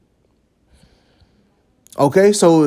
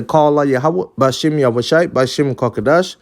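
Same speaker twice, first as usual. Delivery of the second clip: lower, formal benediction voice; closing blessing; 6 semitones lower than usual. Acted. Preacher sending off listeners with a low, formal blessing. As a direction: intone the blessing to close the lesson.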